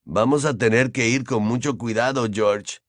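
plain reading voice

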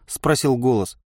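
ordinary delivery

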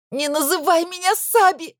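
high, piercing scream; frantic scream. Acted. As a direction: scream it in despair. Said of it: "закричала я истошно".

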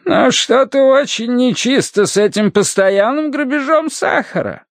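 high-pitched whining character voice; plaintive complainer. A narrator's reading. Solomon Tally bemoans his losses on the docks, high-pitched whine. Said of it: "плакался о своих потерях".

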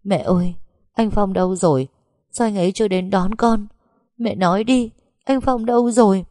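crying out desperately to mother; wailing cries. Acted. Grieving wife wailing at mother for her husband, building cries. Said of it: "gào thét ầm ĩ".

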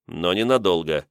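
plain reading voice

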